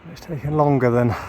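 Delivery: ordinary delivery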